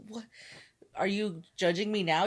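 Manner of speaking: usual speaking voice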